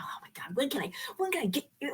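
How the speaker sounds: breathless whispered oh my God; exasperated whisper. Real, not acted. Teacher hearing the radio ad about her, whispered. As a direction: whisper it in disbelief.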